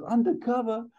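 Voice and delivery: very high, incredulous echo; acted high, exasperated comeback; Axel Foley. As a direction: throw it back in a high-pitched squawk. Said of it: "Axel goes".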